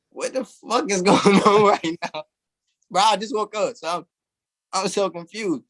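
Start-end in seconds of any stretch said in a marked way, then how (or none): 0.1-2.2 high, laughing through the question; laughing disbelief. Retold or imitated. Cop sings his song, asked through laughter.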